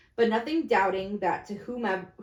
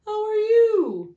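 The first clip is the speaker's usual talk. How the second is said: much higher, slow, loud greeting; hearty businessman; 14 semitones higher, 10 dB louder, slower than usual. Acted. One businessman greeting another, high and slow. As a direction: call out a grand hello.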